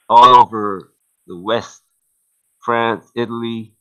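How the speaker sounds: ordinary delivery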